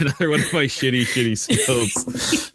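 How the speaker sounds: high, quick laughing words. Real, not acted. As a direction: laugh through the complaint. Laughs at his bad skill roll.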